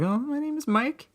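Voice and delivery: high, meek self-impression; high timid voice. Imitating himself answering the host, timid and high. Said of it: "I was like".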